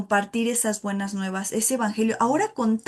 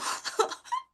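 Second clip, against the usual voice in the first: laugh; 8 dB quieter than usual. Real, not acted. Laugh after admission.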